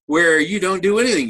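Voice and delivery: ordinary delivery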